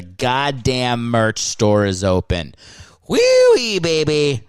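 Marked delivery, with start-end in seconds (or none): 3.1-4.4 excited squeal. Real, not acted. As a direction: squeal it much higher and louder. Celebrating merch store opening.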